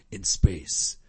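deep, slow movie trailer voice; horror trailer voice. Acted. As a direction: growl it like a horror movie tagline. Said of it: "I have the tagline for Freddy in space".

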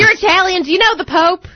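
high, excited Texan voice; hammed-up Texas twang; Texan accent. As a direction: ask like a naive, amazed Texan. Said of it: "I knew a guy from Texas".

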